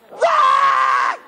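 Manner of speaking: loud mock wail; acted shriek of a frightened onlooker; terrified child's scream. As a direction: scream in hammed-up horror. Watching boy screams in terror.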